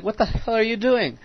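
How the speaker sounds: incredulous. Retold or imitated. Retold shock at classmate shaving.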